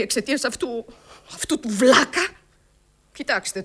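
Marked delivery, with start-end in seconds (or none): 1.3-2.3 contempt. Acted. Insult toward the brother.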